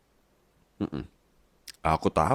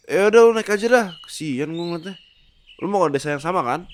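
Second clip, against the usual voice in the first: higher, louder brash slang; cocky pig Lipin; 10 semitones higher, 6 dB louder than usual. Acted. Lipin the pig hollering at Marco on the road. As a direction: talk like a cheeky street-smart pig. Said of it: "babi lain naik Lamborghini, namanya Lipin".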